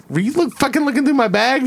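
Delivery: high-pitched mock confrontation; retold indignant question. Student confronting vice principal about his bag, mock-indignant.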